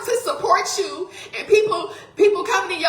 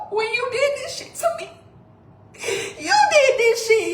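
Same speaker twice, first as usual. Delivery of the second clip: tearful accusation; 5 semitones higher than usual. Real, not acted. Accusing husband while crying.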